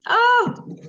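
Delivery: high, moved gasp of feeling; touched, emotional. Real, not acted. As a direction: let the emotion catch your voice. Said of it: "that touched my heart".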